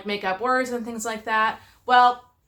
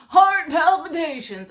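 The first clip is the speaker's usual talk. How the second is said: "heart palpitations" stretched slow, higher and louder in mock whine; mock whiny excuse; 5 semitones higher, 4 dB louder, slower than usual. Wife mocks husband's constant excuse, slow and whiny. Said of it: "husband's excuse for literally everything".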